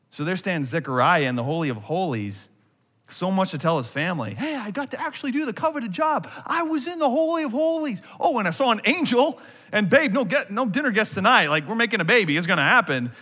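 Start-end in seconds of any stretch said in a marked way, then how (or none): 4.3-13.1 high, eager rush of news; excited telling. Acted. Zechariah bursting to tell his family, excited. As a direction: burst out with it, pitched way up.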